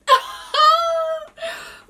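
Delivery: very high, loud, drawn-out squeal; delighted squeal. Real, not acted. Shopper unboxing her new bag, very high delighted squeal.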